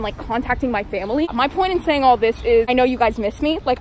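muffled behind mask; masked speech outdoors; through a face mask. Real, not acted. Seen 2: mask over mouth and nose throughout; reference frames unmasked.

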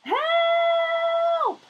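a very high, loud, hammed-up scream for help; cry for help. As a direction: shriek it like a cartoon character in trouble. Character cries out in story.

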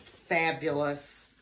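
usual speaking voice

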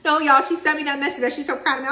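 high, loud and fast; disbelieving outburst. Real, not acted. Telling viewers about the dean's text, loud, high and fast. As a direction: burst out to viewers, voice shooting up. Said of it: "So I was crying".

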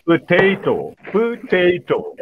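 "Potato" with a short puh first, then long TAY; schwa pronunciation. Shown as a demonstration. Trainer demonstrates schwa to teachers, careful schwa pronunciation. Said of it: "you have the schwa sound there".

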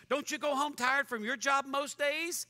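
normal talking voice

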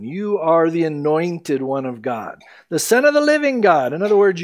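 ordinary delivery

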